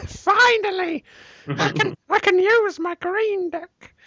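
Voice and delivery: very high, slow, giddy fan voice; hammed-up high-pitched delight; mock fan glee. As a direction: gush like a kid finally getting the game.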